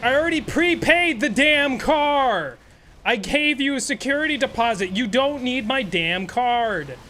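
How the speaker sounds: mock outrage at the counter; indignant. Arguing with rude clerk.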